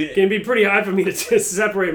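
can't keep a straight face while talking; laughing through it. Real, not acted. Still cracking up at his own botched accent, laughing through words.

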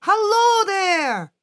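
high, slow, loud hearty greeting; big friendly tree voice; friendly pine tree. Acted. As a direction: boom it out slowly and friendly. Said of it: "said a friendly pine tree".

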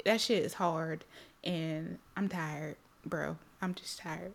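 soft, worn-out sigh of a voice; low and drained, barely getting it out; tired. Retold or imitated. Retelling her therapy session, weary and soft. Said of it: "I was just like".

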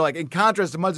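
usual speaking voice

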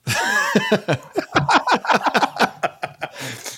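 long trailing giggle; long laugh. Real, not acted. Host cracks up at own joke, long laugh. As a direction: crack up and let it run out.

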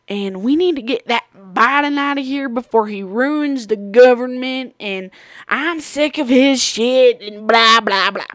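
put-on Southern accent. Old lady griping politics to my coworker, Southern accent. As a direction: imitate a fed-up Southern complainer. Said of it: "I don't know why I went Southern".

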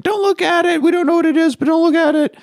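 put-on very high, loud squeal of a scared kid; panicked kid voice. Kids warning each other about the light, high panicked squeal. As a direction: shriek it high like a frightened child.

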